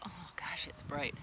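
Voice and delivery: near-whispered, breathy aside at the glare; softly muttered "Oh gosh, it's bright"; hushed aside. Real, not acted. Squinting at bright light, hushed.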